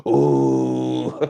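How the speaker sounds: low, drawn-out imitated ooh; Jamie Carragher impression. Streamer imitating a comedian's take on a football pundit, low drawn-out ooh. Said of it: "the Jamie Carragher where he just goes".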